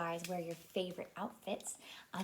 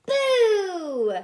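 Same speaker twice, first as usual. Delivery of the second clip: loud, high boo; loud playful ghost boo; spooky boo; 10 semitones higher, 12 dB louder than usual. Acted. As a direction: shout boo to scare. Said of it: "Little Blue Truck says".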